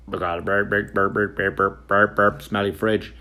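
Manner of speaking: lightly mimicked radio squawk, low; mock walkie-talkie chatter before the words. Clerk radioing the lobby in mock walkie-talkie voice. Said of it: "on the walkie-talkie".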